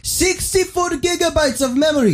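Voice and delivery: very high, slow, hammed-up Indian accent; pushy Indian salesman. Salesman pushes the free Samsung, in a mock Indian accent. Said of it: "He goes".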